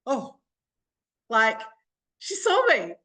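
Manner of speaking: light up as you realise; delighted surprise. Retold or imitated. A listener on a group call, surprised and delighted. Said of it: "you're like".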